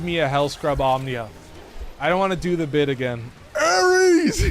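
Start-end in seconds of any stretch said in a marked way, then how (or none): 3.6-4.5 yelled much higher and louder; excited shout. Real, not acted. Streamer shouting a god's name mid-game, loud and high. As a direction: belt out the name. Shouting god names while playing.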